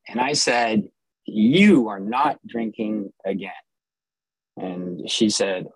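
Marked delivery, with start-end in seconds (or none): 1.2-3.5 slow, emphatic stern order. Retold or imitated. Husband confronting his wife about drinking, stern. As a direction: lay down the law, slowly. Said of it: "I mean, I was really stern".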